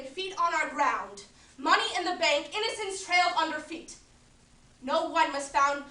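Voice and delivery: a woman lamenting lost miracles, solemn with loud swells of conviction; Agnes of God monologue. Acted. A woman grieving to the audience that miracles are gone, solemn. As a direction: mourn the miracles, building to outbursts then falling back.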